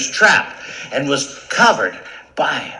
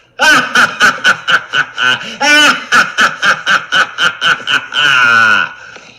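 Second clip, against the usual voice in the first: loud booming mock laugh; big lion laugh; 6 semitones higher, 10 dB louder than usual. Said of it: "The lion laughed".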